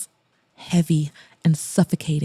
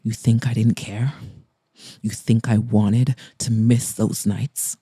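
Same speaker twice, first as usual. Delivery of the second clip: Mason, steady but raw; 8 semitones lower than usual. Acted. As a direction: lower male voice, steady and hurt. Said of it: "His voice was steady but raw".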